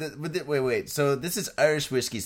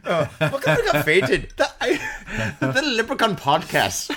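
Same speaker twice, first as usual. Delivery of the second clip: laughing mock Irish; 5 semitones higher than usual. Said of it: "I can't do accents while I'm smiling".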